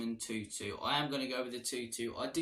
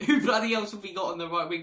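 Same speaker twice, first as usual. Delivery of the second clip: louder, higher, laughing through the question; laughing exasperation; 6 semitones higher, 9 dB louder than usual. Real, not acted. Fan laughing at Spurs' lack of right wingers, exasperated. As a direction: laugh in disbelief at the empty squad.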